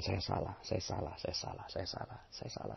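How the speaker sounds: fading from soft to a breathy whisper; whispered confession. Shown as a demonstration. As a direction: let "saya salah" sink into a whisper.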